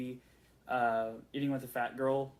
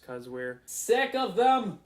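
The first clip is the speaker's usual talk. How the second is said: louder, higher, slow exasperated whine; mock exasperated; 7 semitones higher, 7 dB louder, slower than usual. Fan complaining to the producer about lens flares, mock exasperated and loud. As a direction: drag it out like a fed-up moviegoer.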